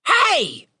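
high-pitched raven screech of a word. A narrator's reading. Raven calls out from above, screeching. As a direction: screech it like a big bird. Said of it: "a loud screech from above".

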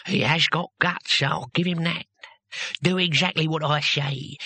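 lightly voiced damp old captor; squelchy voice. Captor voice continues.

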